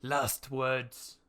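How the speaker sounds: slow, loud and formal; guard's question. Acted. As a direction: demand it of the prisoner. Guard addressing the condemned.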